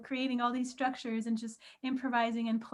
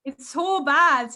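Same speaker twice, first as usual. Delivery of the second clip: slow, louder attempt at a Scottish accent; mock Scottish accent; 8 dB louder, much slower than usual. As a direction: try a Scottish accent, drawn out and loud. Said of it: "how is your Scottish accent".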